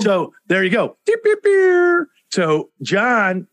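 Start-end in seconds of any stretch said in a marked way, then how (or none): 1.0-2.1 slow high beeping, mimicking a machine; mock sound effect. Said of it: "we do need a sound effect machine".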